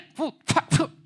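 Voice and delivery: loud percussive mouth bursts; explosive whooshes and pops; imitated wok noises. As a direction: make frantic cooking sound effects. Imitates fast wok cooking.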